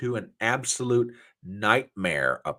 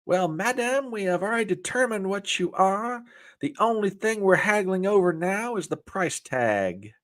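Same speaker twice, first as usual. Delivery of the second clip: much higher, put-on old-time comic voice; W C Fields voice; 10 semitones higher than usual. Joke man propositioning a woman, in a high put-on comic voice. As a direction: croon it like a vaudeville charmer. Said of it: "W C Fields replied".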